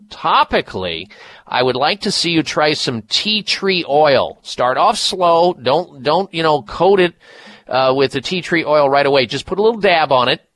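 ordinary talk, nothing marked

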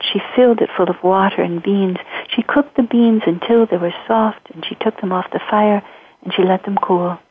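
usual speaking voice

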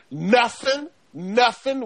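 Southern US drawl, drawn-out "nuffin" with an F; Southern accent. Acted. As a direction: lay on a Southern accent, stretching "Nothing" into "nuffin". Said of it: "in my Southern accent".

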